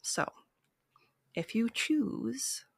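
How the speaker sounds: whisper. Real, not acted. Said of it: "conspiratorial sales pitch".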